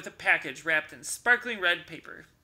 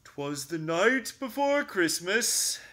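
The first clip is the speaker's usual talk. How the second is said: poem recital; 3 semitones higher, 4 dB louder than usual. Acted. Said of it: "Bear pronounced".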